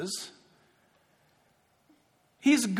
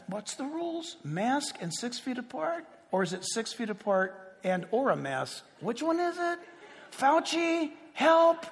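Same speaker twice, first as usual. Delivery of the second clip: confused inner fretting; 3 semitones higher, 6 dB quieter than usual. Retold or imitated. Speaker frets at the DMV.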